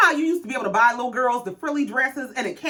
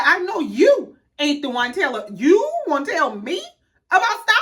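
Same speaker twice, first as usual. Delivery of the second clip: higher-pitched, incredulous retort; indignant clapback; 5 semitones higher, 3 dB louder than usual. Retold or imitated. Tia answering a jab about her style, indignant. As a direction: throw it back, mildly offended and disbelieving.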